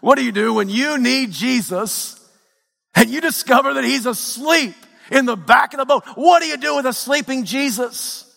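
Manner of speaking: high-pitched, driving sermon delivery; impassioned preaching. Acted. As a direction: build the pain line by line like a fired-up preacher. Preaching at full force.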